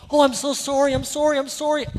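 high, loud string of Canadian apologies; over-apologetic Canadian. Acted. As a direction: gush apologies like an eager-to-please Canadian. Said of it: "like that like a Canadian".

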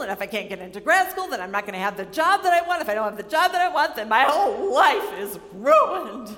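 high, loud, fast spiralling panic; panicked rant. Retold or imitated. Student panicking to her advisor about grades, loud fast panic. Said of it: "Get a little bit dramatic".

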